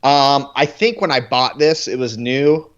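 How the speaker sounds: ordinary talk, nothing marked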